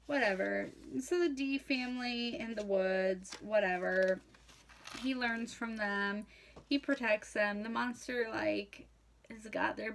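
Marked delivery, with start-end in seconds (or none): none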